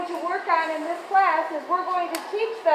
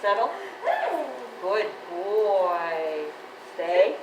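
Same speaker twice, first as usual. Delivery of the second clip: talking to a dog; 5 semitones lower, much slower than usual. Real, not acted. Trainer calming the excited dog.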